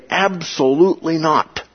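"Absolutely not" pitched high and firm; emphatic statement. Shown as a demonstration. Teacher showing the same thing in English, emphatic "Absolutely not". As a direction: stress "Absolutely not" as a total denial. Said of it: "That kind of emphatic statement".

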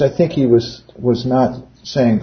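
ordinary talk

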